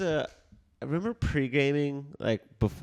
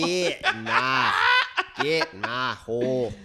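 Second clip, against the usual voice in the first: bad Scottish accent; 6 dB louder, much slower than usual. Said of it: "You sound like fucking Paul Rudd".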